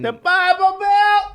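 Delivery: high mock voice. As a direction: repeat it way up high, drawn out. Repeats it in a high voice.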